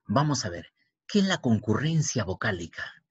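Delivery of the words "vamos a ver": ordinary talk, nothing marked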